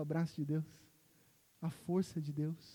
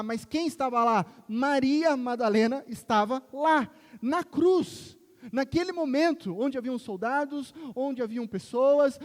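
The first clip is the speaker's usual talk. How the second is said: full-force preaching; 5 semitones higher, 8 dB louder than usual. Acted. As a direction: build to a soaring climax.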